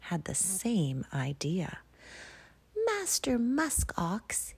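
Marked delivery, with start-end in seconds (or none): 2.8-4.5 lightly voiced hare, higher and unhurried; polite hare. Snowshoe hare politely asking Musk Ox for shelter, higher. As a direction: ask the big ox nicely, a touch higher. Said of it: "he asked politely".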